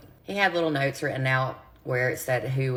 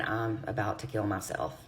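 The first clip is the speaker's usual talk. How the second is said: somber retold line; 5 semitones lower, 6 dB quieter than usual. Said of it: "this time he was somber and serious".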